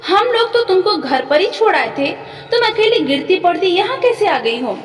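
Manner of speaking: put-on narrated child asking in guileless wonder, pitch lifted; innocent questioning. A narrator's reading. Son questioning the mother he thought dead, innocently. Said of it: "भोलेपन से पूछता था".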